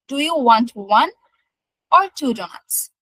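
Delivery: up on "one", down on "two donuts"; choice intonation. Shown as a demonstration. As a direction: rise on "one", pause, then fall through "donuts". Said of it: "first চয়েসে হচ্ছে pitch rise করে".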